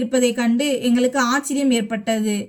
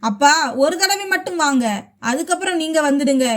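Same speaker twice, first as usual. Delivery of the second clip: plain reading voice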